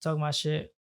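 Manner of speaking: ordinary talk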